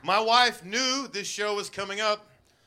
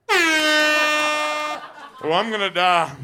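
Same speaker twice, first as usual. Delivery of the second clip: laughing; 8 semitones higher, 4 dB louder, much slower than usual. Real, not acted. Laughing at own joke.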